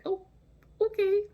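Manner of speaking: tiny high-pitched "okay"; small, high, awkward reply; meek acceptance. Retold or imitated. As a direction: squeak it out meekly, very high.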